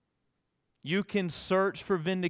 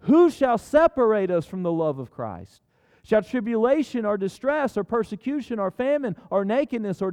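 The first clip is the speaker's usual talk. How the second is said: higher and louder, rolling scripture proclamation; preaching at full force; 7 semitones higher, 5 dB louder than usual. Acted. Pastor reading Romans to the church, full preaching force. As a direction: proclaim it to the back row.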